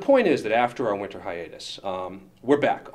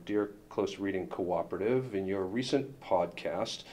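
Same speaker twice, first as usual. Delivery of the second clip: formal letter reading. Acted. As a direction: read it out like a stiff legal notice. Reading a lawyers' letter aloud.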